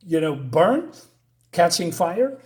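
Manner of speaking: ordinary talk, nothing marked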